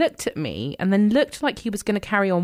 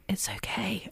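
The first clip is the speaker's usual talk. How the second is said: soft, hushed reassurance; imitating his whisper; 11 dB quieter than usual. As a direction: murmur it gently like someone on voice rest. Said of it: "he's on voice rest or he's, like, whispering".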